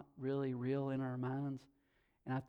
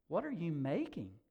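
ordinary talk

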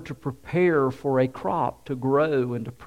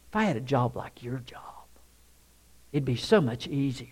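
1 whispered aside; 4 semitones lower, 9 dB quieter, faster than usual. Real, not acted.

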